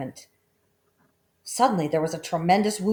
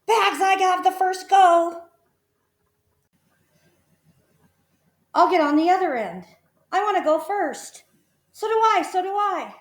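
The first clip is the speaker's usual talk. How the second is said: put-on high, loud eager kids; children squabbling for first go; clamouring children; 13 semitones higher, 6 dB louder than usual. A narrator's reading.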